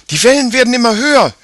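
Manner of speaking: high, loud and fast in fear; alarmed. Acted. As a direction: cry out in rising panic. Mia alarmed by rising waves.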